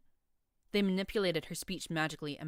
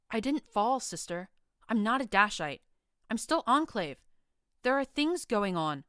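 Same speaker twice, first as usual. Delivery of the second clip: higher, louder, pleading and hurt; brittle, heartbroken; 5 semitones higher, 5 dB louder than usual. A narrator's reading. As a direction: insist through heartbreak. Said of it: "in a brittle, heartbroken voice".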